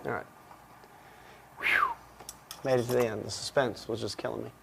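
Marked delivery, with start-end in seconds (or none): 1.6-1.9 relieved sigh. Real, not acted. Finishing a long wrap.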